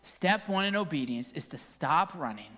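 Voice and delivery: low, soft, tender preaching; quiet, measured closing plea; hushed sermon appeal. Real, not acted. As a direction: bring the message down to an earnest near-whisper.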